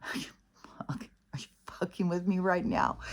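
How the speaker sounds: breathy whispered disbelief through laughter; whispering in half-laughing disbelief at the tire shop. Retold or imitated. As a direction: laugh it out under your breath. Laughing at absurd tire charge.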